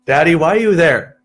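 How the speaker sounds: high, loud imitation of a child; pleading young daughter. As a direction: plead like a kid left behind. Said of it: "my oldest, she was like".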